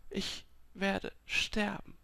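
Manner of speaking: slow, high, soft and fearful; stunned dread. A narrator's reading. Dying woman absorbing that she will die, slow fearful hush. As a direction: break it into slow, faint words. Repeats it, giving up.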